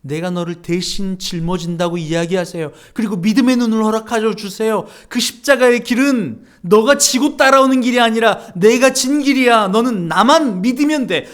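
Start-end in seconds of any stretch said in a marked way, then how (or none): 3.0-11.3 louder, higher preaching voice that keeps pushing; fervent preaching. Acted. Pastor urging the congregation to pray, fervent and louder.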